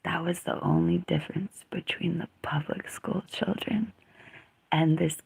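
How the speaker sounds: whispered confidential aside. Real, not acted. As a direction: whisper it like a secret.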